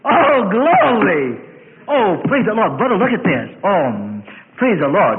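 thrilled praise. Retold or imitated. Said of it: "others that read and they say".

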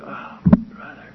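slow, breathy sigh of sympathy; tender sympathy. Retold or imitated. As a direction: hush it like comforting a mourner. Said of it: "You put your arm around them and say".

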